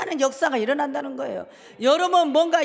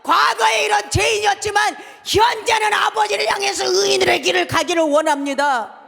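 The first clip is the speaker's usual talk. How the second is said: loud, higher pleading prayer; shouted prayer; 5 semitones higher, 6 dB louder than usual. Shown as a demonstration. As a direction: beg God for an answer. Models repentant prayer aloud. (간절히 외치는 기도)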